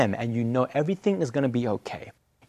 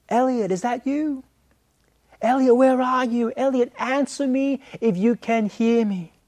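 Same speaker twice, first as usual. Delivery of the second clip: high, anxious mum calling out Elliot's name; mum calling for lost child; 7 semitones higher than usual. Retold or imitated. Mum searching the mall for her lost son. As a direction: call out searching for your missing boy.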